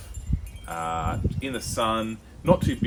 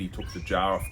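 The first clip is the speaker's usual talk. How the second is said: ordinary talk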